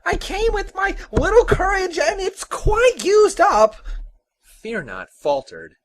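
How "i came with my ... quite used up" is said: very high, loud, frightened little man; panicked high-pitched protest; terrified cry. Acted. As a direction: wail it out in fright. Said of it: "he cried".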